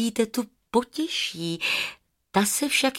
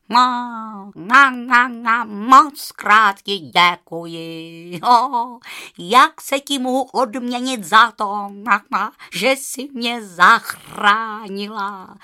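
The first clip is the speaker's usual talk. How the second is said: loud, slow honking bird voice; honking goose; 7 dB louder, slower than usual. A narrator's reading. Grateful goose thanks the girl who saved her, honking. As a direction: stretch the words into joyful honks. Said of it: "zakejhala husa a točila se dokola radostí".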